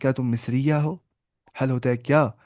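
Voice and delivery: ordinary talk, nothing marked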